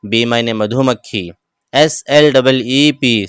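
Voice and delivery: ordinary talk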